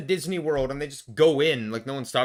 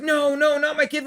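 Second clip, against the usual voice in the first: mock pleading mother; 7 semitones higher, 4 dB louder than usual. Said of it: "And she's like".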